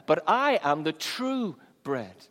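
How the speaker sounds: "true" stretched out slowly and weighted; slow down and lean on "true"; stress on true. Shown as a demonstration. Jesus contrasts himself with the manna, stress on "true". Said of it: "emphasis on the word true".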